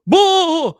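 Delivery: loud mock boo. Sudden outburst after quote.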